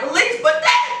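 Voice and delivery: high, squeaky mock panic. A listener panicking to the host, high mock squeal. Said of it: "I know you're like".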